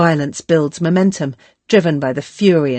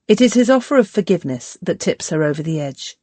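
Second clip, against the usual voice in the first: ordinary delivery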